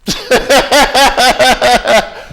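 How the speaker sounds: bursting into a high, loud laugh. Real, not acted. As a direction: laugh it out, delighted. Laughs at own memory.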